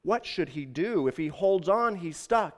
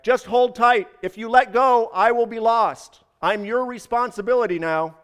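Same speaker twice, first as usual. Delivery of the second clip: loud, high urgent plea; insistent call, raised in pitch and volume; calling back; 6 semitones higher, 6 dB louder than usual. A narrator's reading. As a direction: shout instructions up from below. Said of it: "the voice called back".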